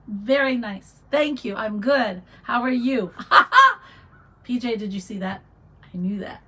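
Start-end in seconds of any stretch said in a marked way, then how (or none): 3.2-3.8 a burst of high, loud laughter; sudden high delighted laugh. Real, not acted. Laughs at viewer comment.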